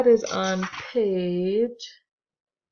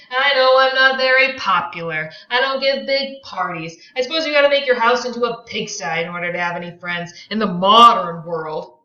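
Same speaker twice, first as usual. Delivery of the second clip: higher, louder aggrieved tirade; Tom ranting; 4 semitones higher, 8 dB louder than usual. Acted. Tom rants about his unpopularity, high and loud. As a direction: complain bitterly, loud. Tom's self-pitying rant.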